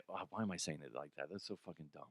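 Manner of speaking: very quiet, low mutter to yourself; self-mocking aside. Real, not acted. Host criticising his own delivery, muttered aside. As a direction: drop right under your breath, embarrassed.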